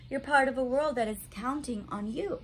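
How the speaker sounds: plain reading voice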